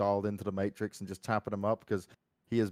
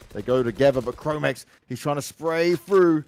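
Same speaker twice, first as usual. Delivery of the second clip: louder live fight call; esports caster; 6 semitones higher, 9 dB louder than usual. Acted. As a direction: push the energy as the fight breaks.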